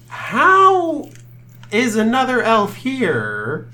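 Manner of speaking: high, slow, bewildered elf voice; confused elf. Acted. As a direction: play a baffled elf, drawn out.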